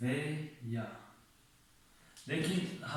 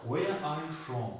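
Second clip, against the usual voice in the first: slow model question; 3 semitones higher, much slower than usual. Shown as a demonstration. Teacher putting the question into a sentence, slow and clear. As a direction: stretch out where to model the question.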